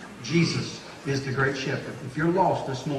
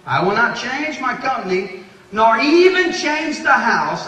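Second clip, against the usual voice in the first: much higher and louder, slow on the last words; preaching climax; 7 semitones higher, 9 dB louder than usual. Real, not acted. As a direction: lift the quote to a ringing peak. Climactic quote.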